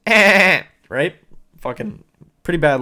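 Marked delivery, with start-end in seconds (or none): 0.1-0.6 a short, high, loud bark of a laugh; mock cackle; imitated honking laugh. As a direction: mimic the lady's bad laugh in one blast. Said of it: "she goes".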